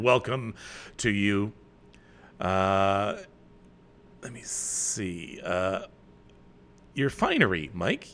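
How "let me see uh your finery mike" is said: usual speaking voice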